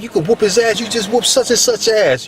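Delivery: egging on. Retold or imitated. Older dudes egging him on.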